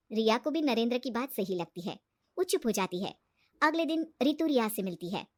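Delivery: ordinary delivery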